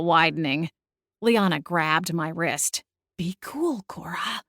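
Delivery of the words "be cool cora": hushed, softer warning; urgent hiss. A narrator's reading. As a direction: hiss it under your breath. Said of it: "she hissed".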